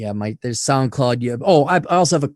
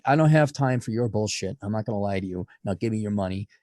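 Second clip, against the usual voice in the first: low, soft deadpan brush-off; deadpan blunt; 5 semitones lower, 5 dB quieter than usual. Retold or imitated. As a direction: say it flat and uncaring, much softer. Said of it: "the theme of asshole consulting is like".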